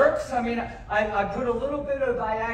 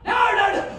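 alarmed; 11 semitones higher, 5 dB louder than usual. Real, not acted. Reacting to a missed throw.

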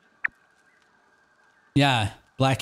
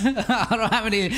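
laughing; 7 semitones higher than usual. Real, not acted. Laughing at own situation.

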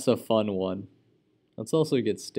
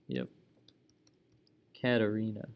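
ordinary talk

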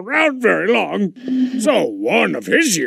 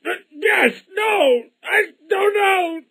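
flustered; 11 semitones higher than usual. Acted. Confused flustered outburst.

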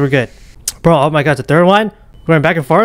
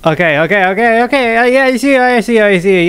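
rapid babble climbing much higher; mounting panic; 6 semitones higher than usual. Real, not acted. Gamer saying they see it, mounting panic. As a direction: pitch rises with each frantic repeat.

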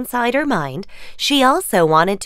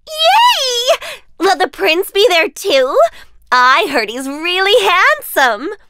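much higher, loud squeal of joy; giddy stepsister; 11 semitones higher, 5 dB louder than usual. Acted. Stepsister squealing about the handsome prince, high and giddy.

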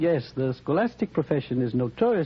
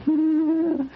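sinister laugh; 11 semitones higher, 4 dB louder than usual. Acted. Villain gloating over drugged guest.

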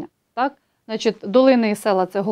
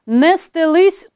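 «Не стелись» drawn out slowly, high and louder; poem recitation; 6 semitones higher, 4 dB louder, much slower than usual. Shown as a demonstration. Teacher reciting poem example to students, «Не стелись» slow and lifted. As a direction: declaim «Не стелись» like verse.

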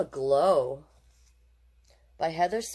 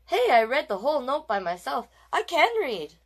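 thrilled, much higher and louder; excited; 8 semitones higher, 6 dB louder than usual. Acted. Ronald realizing he read the note alone, thrilled shout. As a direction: burst out with a child's joy.

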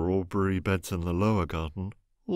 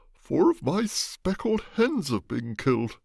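upset exclamation, higher and louder; exclaiming; 9 semitones higher, 5 dB louder than usual. Acted. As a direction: blurt out the bad news. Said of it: "he exclaimed".

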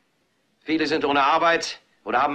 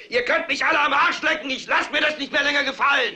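higher, raging yell; shouted defiance; 8 semitones higher than usual. Acted. Anchor shows viewers again what to shout, yelling. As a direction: scream it out the window. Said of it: "steckt den Kopf raus und schreit".

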